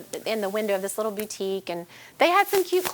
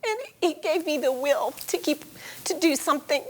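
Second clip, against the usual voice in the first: ordinary delivery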